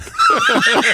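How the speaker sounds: high, loud burst of laughter. Real, not acted. Laughing hard while telling the radio host the twist. Recalling mom's shocking answer.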